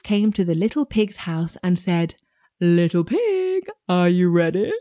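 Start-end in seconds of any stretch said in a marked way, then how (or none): 2.6-4.7 sly wolf voice. Acted. Wolf calls at door.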